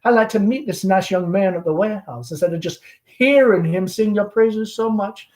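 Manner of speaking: lightly voiced chatty Southern belle mother; Southern mother hostess. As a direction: gush over the guest like a fading belle. Amanda hosting the gentleman caller.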